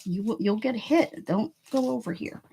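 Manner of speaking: ordinary talk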